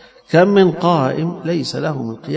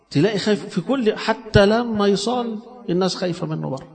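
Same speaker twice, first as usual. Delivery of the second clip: ordinary talk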